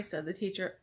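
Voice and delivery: ordinary delivery, nothing marked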